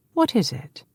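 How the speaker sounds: put-on gentle older brother to a scared boy. A narrator's reading. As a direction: soften for a fragile brother. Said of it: "in a completely different, gentle voice".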